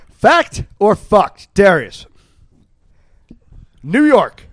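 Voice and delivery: game show host. Acted. Host asks contestant.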